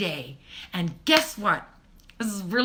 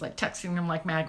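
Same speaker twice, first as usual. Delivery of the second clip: normal talking voice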